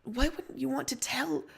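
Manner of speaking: ordinary delivery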